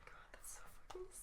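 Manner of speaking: whispered, choked up; fighting back tears, fast and breathless; real tearful whisper. Real, not acted. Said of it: "cry whisper".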